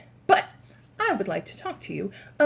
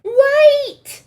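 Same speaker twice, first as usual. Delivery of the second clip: high piercing witch shriek; 13 dB louder than usual. Acted. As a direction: shriek to stop everyone. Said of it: "shrieked one of the witches".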